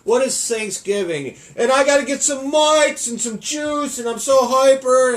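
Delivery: high, loud, slow hammed-up hyper voice; mock hyperactive contestant; hyper Jay impression. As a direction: bounce off the walls like a hyper kid. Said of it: "Jay would be like".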